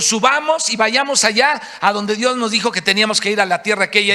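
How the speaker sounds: rally the crowd to march up; loud call to go. Retold or imitated. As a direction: crowd urging each other on. People deciding to go up.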